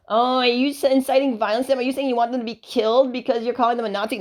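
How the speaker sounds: high, whiny mock of a liberal accuser. Mimicking a liberal who accuses him of inciting violence, high mocking whine. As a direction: sneer it like a pearl-clutching liberal. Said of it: "that's a liberal logic".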